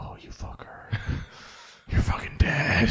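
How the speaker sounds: whispered playful threat to the dog; mock-menace the dog in a whisper; slow, hushed fake threat. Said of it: "I'm like".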